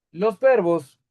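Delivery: normal talking voice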